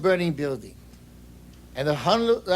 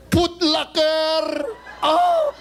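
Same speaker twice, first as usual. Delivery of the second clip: mock overexcited wail; 9 semitones higher, much slower than usual. Imelda losing it over shoe stores, a hammed-up high wail. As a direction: break into a hammed-up sob of longing. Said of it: "I'm getting all excited".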